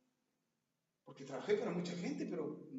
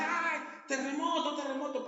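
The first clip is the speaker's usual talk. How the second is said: acted prisoners' screaming; 9 semitones higher, 6 dB louder than usual. Prisoners crying out in an earthquake, acted panicked yell. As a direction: yell like a panicked crowd in mock terror. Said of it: "empiezan a gritar".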